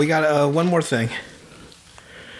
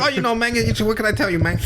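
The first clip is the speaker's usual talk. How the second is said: high-pitched, hammed-up movie gangster; amateur gangster impression pitched way up; Tony; 7 semitones higher than usual. Gangster shrugging off failure to his boss on the phone, hammed-up high voice. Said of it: "I can't do a good Tony face".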